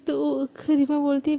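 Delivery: ordinary delivery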